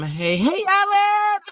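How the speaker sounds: high sing-song hey Ellen; Aunt Ellen call. Shown as a demonstration. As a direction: call out Ellen like a family greeting. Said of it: "that's how we're gonna say it".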